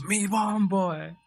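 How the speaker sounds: put-on high, drawn-out Jamaican-accented exclamation; imitated Jamaican accent. Streamer quoting the onlooker after the miss, mock Jamaican accent. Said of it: "the other guy goes".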